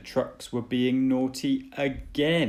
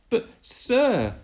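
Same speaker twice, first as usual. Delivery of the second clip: much higher, loud pleading voice; protesting engine; 14 semitones higher, 6 dB louder than usual. Acted. Thomas objecting to the Fat Controller, high pleading. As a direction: protest quickly to the boss. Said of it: "Thomas protesting".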